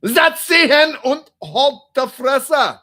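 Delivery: high, very loud barked German command; mean German voice. Shown as a demonstration. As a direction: shout it like a harsh, authoritative German. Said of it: "like, a mean German voice say".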